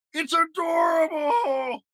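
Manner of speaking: very high, loud, slow horrified shout; horrified wail. A narrator's reading. Big monster wailing as he runs to his mommy. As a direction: flee from something cute. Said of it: "ran away crying".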